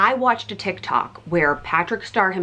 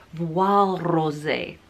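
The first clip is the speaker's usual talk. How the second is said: a hint of a mock French accent on the shade name; weird accent; slower than usual. Naming the powder shade for viewers in a light mock French accent. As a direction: lightly put on a French lilt for Voile Rosé. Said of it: "I did a weird accent for you there".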